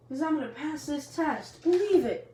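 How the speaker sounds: high, rising to a loud catchphrase; boy boasting to his teammates, loud finish; cocky boast. Acted. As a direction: end on a shouted catchphrase. Naruto boasts he will pass.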